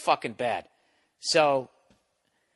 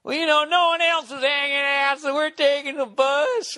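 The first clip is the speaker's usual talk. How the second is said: mocked drunk guy; 13 semitones higher, 5 dB louder than usual. Drunk shitbird walking up to the bus line, mocking imitation. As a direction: exaggerate the drunk's whine, stretching the words. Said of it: "This fucking shitbird".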